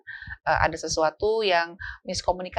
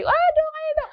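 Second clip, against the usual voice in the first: very high, loud playground cry; high shouting friend; excited friend; 5 dB louder, faster than usual. Retold or imitated. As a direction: yell like a kid urging a teammate. Friend shouting during game.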